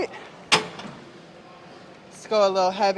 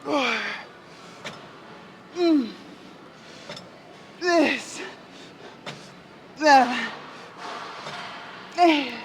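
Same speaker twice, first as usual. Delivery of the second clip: straining; 5 semitones higher, slower than usual. Real, not acted. Counting reps under heavy lift.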